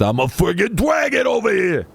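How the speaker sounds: high, shouted tough-guy dragon voice; Estinian voice. Acted. Warming up an impression for the stream, Estinian voice. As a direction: holler it in a put-on character voice. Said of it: "get my Estinian voice first".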